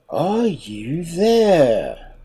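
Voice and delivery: slow, drawn-out, mildly cross shark call; a touch of impatience, calling again. Acted. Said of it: "louder than before, but in a very cross voice".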